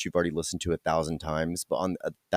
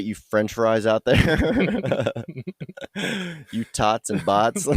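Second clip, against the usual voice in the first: slow, giggling through the words; laughing; 4 semitones higher, slower than usual. Real, not acted. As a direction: laugh while saying it. Joking sign-off to listeners.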